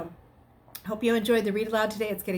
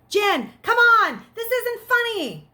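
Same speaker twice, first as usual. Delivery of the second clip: loud, high, slow first words; frustrated calling out; 14 semitones higher, 9 dB louder, slower than usual. Acted. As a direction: yell at a hiding friend. Frustrated calling through empty house.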